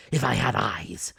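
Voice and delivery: loud bitter lament; bitter cry. Acted. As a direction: curse his blindness. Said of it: "he cried".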